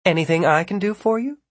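high, quick, sweetly pleasant offer. A narrator's reading. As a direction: lilt it up brightly, all politeness. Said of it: "Pat asked pleasantly".

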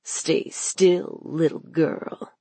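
lightly voiced evil man, hushed; a hint of quiet menace; mocking villain. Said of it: "laughter from the evil man".

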